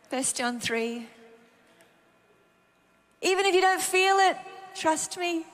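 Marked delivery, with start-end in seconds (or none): 3.2-5.5 high, sustained, slowing declarations; preached assurance. Real, not acted. Preacher assures listeners they are forgiven and lovely, preached assurance.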